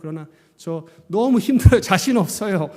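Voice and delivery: acted-out desperate prayer; pleading. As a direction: confess it to God insistently. Acts out honest prayer of weakness.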